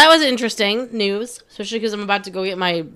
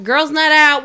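shouted higher and louder party cheer; giddy party girl; 6 semitones higher, 7 dB louder, slower than usual. Acted. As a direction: yell it like a night out starting. Party cheer, second take shouted.

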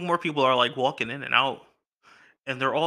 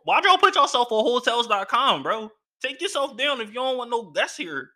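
much higher and louder, a mock-outraged rant; exasperated rant; 9 semitones higher, 4 dB louder than usual. Real, not acted. To his listeners, ranting at the shady hotel, higher and louder. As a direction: vent at the hotel like it wronged you.

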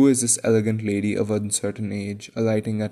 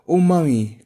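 put-on loud, high child's exclamation; piping, eager outburst; excited cry; 7 semitones higher, 9 dB louder than usual. A narrator's reading. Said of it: "cried the first twin".

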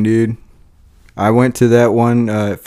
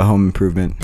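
ordinary delivery

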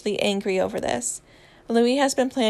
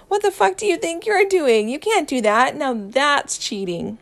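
loud protest; 5 semitones higher, 4 dB louder than usual. A narrator's reading. Louis objects to Harry removing letters, loud protest.

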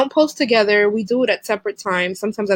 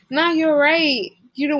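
ordinary delivery

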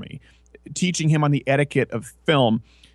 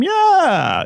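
much higher, louder drawn-out yeah; mock eager yes; 14 semitones higher, 4 dB louder than usual. Pretend poll respondent answering brightly, hammed-up. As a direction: chirp it cheerfully.

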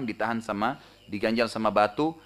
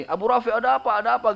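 higher voice, hinting at a crowd's commotion; lightly voiced clamour; 10 semitones higher, 4 dB louder than usual. Attacker posing as a crowd asking Abu Rafi, lightly imitated clamour. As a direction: imitate many people calling out at once. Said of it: "dengan suara gemuruh seakan-akan ramai orang".

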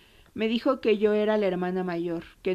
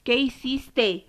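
retold cry; 5 semitones higher, 3 dB louder, much slower than usual. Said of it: "Roque pegó el grito".

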